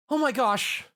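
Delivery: high, startled outburst; exclaiming. A narrator's reading. Twilight realises she forgot the police, high and startled. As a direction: blurt it out in sudden alarm. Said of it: "Twilight exclaimed".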